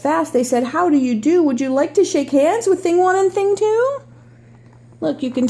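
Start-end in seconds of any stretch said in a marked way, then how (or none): 0.3-4.0 storybook read-aloud voice; reading to children. Acted. Reads picture book aloud.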